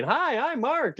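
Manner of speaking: high-pitched friendly intro; shrill greeting. Shown as a demonstration. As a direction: say hi in a shrill squeak. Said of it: "fine line between".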